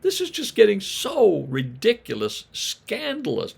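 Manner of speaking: shooting way up in pitch, aghast; high-pitched, scandalized disbelief; mock outrage. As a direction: play the scandalized neighbor, voice leaping high. Mock outrage at the father's lavishness.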